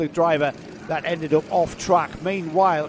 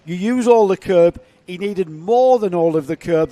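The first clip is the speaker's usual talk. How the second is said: excited commentary; 6 semitones higher, 7 dB louder than usual. Acted. Live play-by-play of the race.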